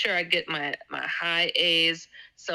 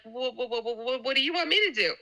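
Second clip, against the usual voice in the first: flustered stammer, rushing the question; retold stuttering; 7 semitones higher, faster than usual. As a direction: stumble over wha- before blurting the question. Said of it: "I'm stuttering again".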